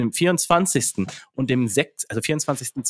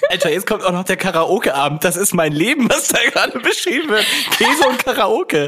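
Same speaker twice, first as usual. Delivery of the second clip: laughing; 9 semitones higher, 5 dB louder than usual. Real, not acted. Laughing excitedly at the quote.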